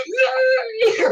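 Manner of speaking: put-on high, slow, squealed yay like a thrilled child; little kid cheer. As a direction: cheer like a kid on Christmas morning. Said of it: "like, little kid".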